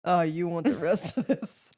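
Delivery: slow, knowing dig; pointed offer. Retold or imitated. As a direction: offer it slowly with a hint of insult. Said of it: "he definitely was like".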